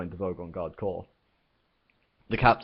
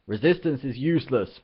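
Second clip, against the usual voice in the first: loud, higher bellowed slogan; 6 semitones higher, 5 dB louder than usual. Acted. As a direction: boom the drill phrase. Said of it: "bellowed the guard".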